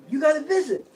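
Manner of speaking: high, loud called-out message; yelling. Retold or imitated. Guard tells prisoner about a visit, yelling. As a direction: holler it down the tier. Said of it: "I'm yelling down the gate".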